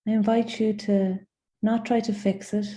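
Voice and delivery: gentle guided meditation with long pauses; unhurried invitations, each left to settle in silence. Acted. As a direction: guide them inward, phrase by phrase.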